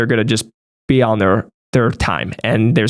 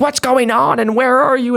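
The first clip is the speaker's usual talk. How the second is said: high, put-on voice of an impatient caller; mock caller; 12 semitones higher than usual. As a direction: mimic the nagging caller, pitched way up. Impatient callers demanding his whereabouts.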